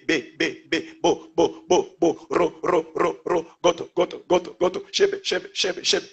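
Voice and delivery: steady, evenly spaced repeated syllables; demonstrating glossolalia as strings of repeated syllables; syllable-string tongues demo. Shown as a demonstration. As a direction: chant out short syllables in a regular beat. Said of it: "une suite de syllabes".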